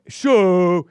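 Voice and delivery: hammed-up manly SHOW, briefly held; mock manly call. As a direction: punch out SHOW big and loud. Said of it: "trying to sound all manly".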